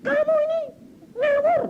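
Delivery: very high, loud mock fury; hammed-up scolding, pitch shooting up; retold angry outburst. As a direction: act out an indignant shriek.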